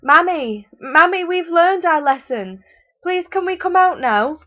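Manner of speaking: put-on high, loud child's plea; calling out. A narrator's reading. Locked-in children calling out to their mother, pleading. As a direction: call out begging from the cupboard. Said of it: "called out".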